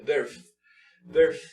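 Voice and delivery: "birth" with the final TH close to an F; demo English sound. Shown as a demonstration. Teacher demonstrating "birth" to Spanish speakers. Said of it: "Tratar de hacer como una F".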